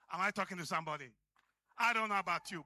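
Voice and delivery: ordinary delivery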